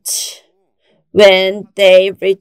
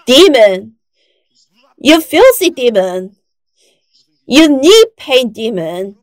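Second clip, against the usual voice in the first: loud, higher rebuking shout; retold shout; 4 semitones higher, 4 dB louder than usual. Pastor on the subway stairs rebuking a demon, loud retold shout. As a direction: rebuke the demon loudly, like an exorcism. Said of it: "So I suddenly shouted".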